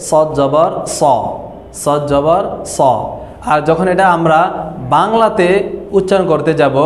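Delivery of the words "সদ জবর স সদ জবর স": heavy, rounded Arabic স in সদ; careful demo of a full-mouthed স; thick pronunciation. Shown as a demonstration. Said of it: "মোটা করে উচ্চারণটা".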